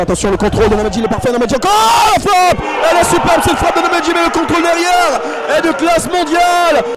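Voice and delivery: rising into a goal call; soaring excited play-by-play; excited sports commentary. Real, not acted.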